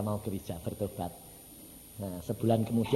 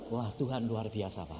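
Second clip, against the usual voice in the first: ordinary talk, nothing marked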